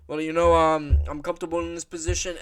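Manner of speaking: rough, half-hearted Aussie voice, quick; Australian accent. Retold or imitated. A fan retrying his Volk impression in Australian accent. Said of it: "I can't do a fucking Australian accent".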